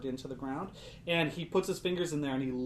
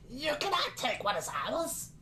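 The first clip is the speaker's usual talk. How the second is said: much higher odd creature voice; halting creature voice; 10 semitones higher than usual. Acted. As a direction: speak like it barely knows the language. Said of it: "doesn't really seem like it knows how to speak".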